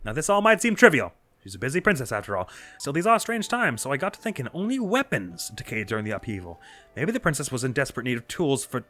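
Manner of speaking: high, upbeat bird reporter; chirpy newsman eager for a scoop; cheerful reporter voice. Acted. Voices Penn dialogue.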